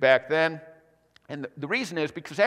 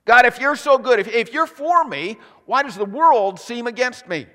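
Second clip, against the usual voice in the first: louder, higher pleading cries; anguished demand; 6 semitones higher, 7 dB louder than usual. Acted. Believer demanding answers from God, louder anguished cries. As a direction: hurl the whys at God.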